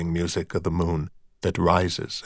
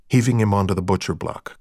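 usual speaking voice

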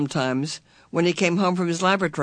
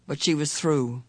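plain reading voice

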